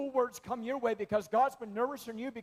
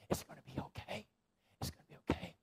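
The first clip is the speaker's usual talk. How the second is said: soothing whisper; 2 semitones lower, 10 dB quieter, faster than usual. Shown as a demonstration.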